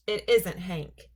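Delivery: usual speaking voice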